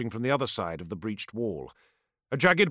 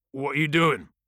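demanding. Acted. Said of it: "demanded Myrak".

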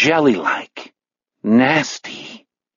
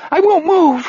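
much higher, terrified gibbering. A narrator's reading. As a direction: babble it in panic. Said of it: "he gibbers".

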